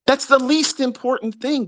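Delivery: exasperated repeat. Shown as a demonstration. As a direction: say it again pitched high in disbelief.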